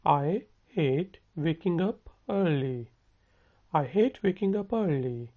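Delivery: very slow, each word spelled out for learners; slow it right down so students can copy; slow model sentence. Shown as a demonstration. Teacher slowly modelling a question for students to repeat. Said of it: "मेरे साथ बोलें".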